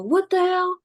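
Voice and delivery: retold angry call across the hallway; Booker. Boss calling across the hallway, mock outrage. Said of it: "hear Booker across the hallway go".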